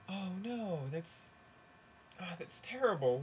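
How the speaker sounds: softly hammed-up dismay; acted dismay. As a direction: take bad news in a hushed, dismayed way. Mother hears bad news in joke.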